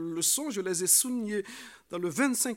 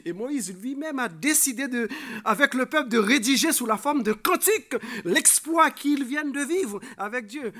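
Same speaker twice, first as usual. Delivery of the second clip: loud, raised preacher's delivery; emphatic preaching; 6 semitones higher, 9 dB louder than usual. Real, not acted. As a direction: drive the story forward with force. Preacher extols the canticle. (prédication vigoureuse)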